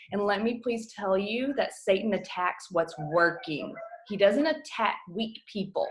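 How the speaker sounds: usual speaking voice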